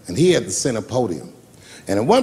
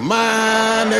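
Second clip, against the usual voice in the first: high, long drawn-out first word; slow, high mock-chummy holler; hammed-up hearty greeting; 8 semitones higher, much slower than usual. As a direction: stretch it out like greeting an old buddy. Acts out overfamiliar greeting to royalty.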